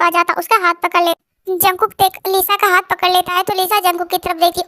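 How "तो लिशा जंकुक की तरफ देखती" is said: usual speaking voice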